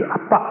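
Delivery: "Appa" stressed on the second syllable; Shanghai dialect pronunciation. Shown as a demonstration. Preacher showing how Shanghai says Father, "Appa" end-stressed. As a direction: say "Appa" with the weight on its final "pa". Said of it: "emphasis on the second syllable".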